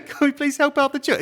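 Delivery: high, put-on English villager; little village man. Parodying an English village fundraiser, high little-man voice. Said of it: "sounds like a little man in the village".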